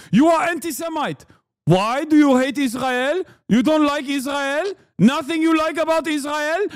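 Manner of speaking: put-on high-pitched, indignant Israeli-accent rant; heavy Israeli accent, climbing into a pleading shriek; mock Israeli accent. Israel defender berating a critic, in a high mock Israeli accent. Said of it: "please stop with this accent".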